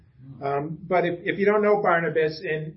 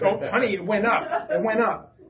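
excited update; 4 semitones higher than usual. Retold or imitated. Husband excitedly telling his wife the covid cases rose, eager higher voice. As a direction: blurt the numbers out like big news.

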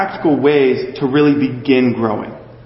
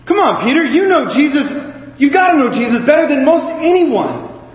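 much higher, teasing come-on-Peter tone; mock exasperation; 8 semitones higher than usual. Preacher teasingly chides Peter for doubting, higher mock exasperation. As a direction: playfully scold him, pitched up. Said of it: "I go".

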